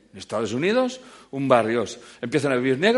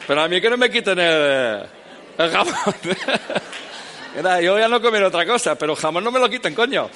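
loud, higher laughing protest about the ham; 8 semitones higher, 7 dB louder than usual. Real, not acted. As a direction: laugh while you insist on your ham, louder and higher. Joking passionately about keeping ham. (reclamando el jamón entre risas, más alto y más agudo)

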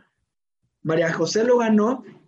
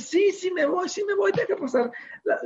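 imitating a girl's high, eager yes; eager daughter; 11 semitones higher, 4 dB quieter than usual. Daughter telling dad she wants to go, eager imitation. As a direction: pitch jumps up in keen agreement.

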